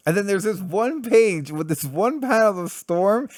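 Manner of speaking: ordinary talk, nothing marked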